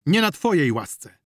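loud, high burst of anger; furious shouted retort; enraged roar. A narrator's reading. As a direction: roar it, losing self-control. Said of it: "ryknął, nie panując już nad sobą".

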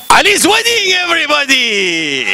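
crowd-hyping announcement. Real, not acted. Comic plugging his film to the audience, high announcer shout. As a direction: proclaim the title to the whole hall.

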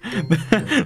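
short real laugh, rising; amused laugh. Real, not acted. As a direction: laugh at how absurd it is. Laughing at the flight attendants.

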